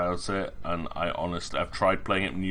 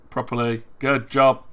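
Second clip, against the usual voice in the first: louder, beaming praise; gleeful praise; 5 semitones higher, 8 dB louder than usual. Real, not acted. Streamer loudly praising the game for saying mum. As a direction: cheer the game on.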